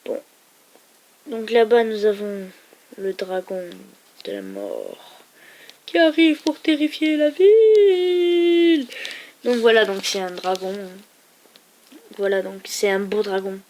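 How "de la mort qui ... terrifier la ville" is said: voix grave et lente puis très aiguë et forte; annonce dramatique. Acted. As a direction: annonce le dragon comme une bande-annonce terrifiante. Présentation du dragon de la mort. (le dragon de la mort présenté d'une voix théâtrale et lente)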